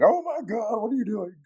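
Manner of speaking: high, startled cry of pain as the ankle is wrenched; pained yelp. Retold or imitated. Retold to a friend, the injured Marine yelps at the physio in pain. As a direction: yelp it out, way up high, like it really hurts.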